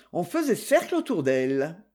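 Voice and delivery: ordinary delivery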